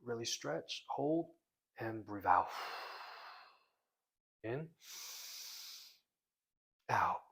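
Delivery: loud, punchy breathing cues; breath coaching. Real, not acted. Coach leading viewers through strong breaths, forceful cueing. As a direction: call each breath with force, between big breaths.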